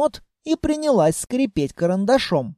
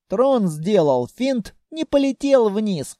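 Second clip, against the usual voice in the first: usual speaking voice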